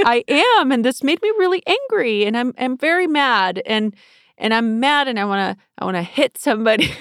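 put-on stomp it out like a cranky kid; angry child voice. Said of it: "you turn into a child".

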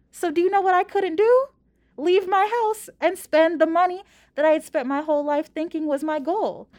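high, animated rhetorical questions; rhetorical build-up. Real, not acted. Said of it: "rhetorical questions to listener".

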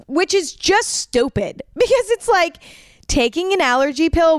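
laughing. Real, not acted. Laughs at herself.

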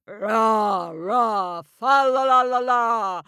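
loud roaring giant voice. Acted. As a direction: bellow it out like a huge giant. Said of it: "the giant roared louder than the sea".

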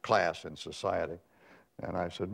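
normal talking voice

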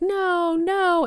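high, drawn-out mock child's cry; imitated child's yelling. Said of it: "and he's yelling".

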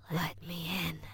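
hushed, dry-throated whisper; eerie, very soft plea from a dry throat; parched whisper. A narrator's reading. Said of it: "he whispered, his voice sounding parched".

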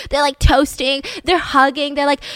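ordinary talk, nothing marked

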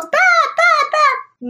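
high, loud alarmed yell; yelling as a bat circles the bedroom; retold yell. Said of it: "and I yelled".

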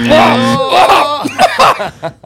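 big loud laugh, high; loud burst of laughter. Real, not acted. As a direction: crack up loudly. Laughing at the otter flip answer.

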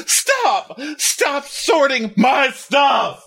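high, slow, hammed-up screaming; husband yelling at his wife over his stuff, high mock shout; mock furious shout. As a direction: yell it out like a tantrum. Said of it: "He goes".

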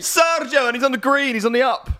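high, loud celebration; excited cheer. Real, not acted. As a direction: cheer him up the table. Cheering Perez's green arrow.

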